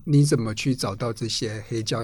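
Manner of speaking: ordinary talk, nothing marked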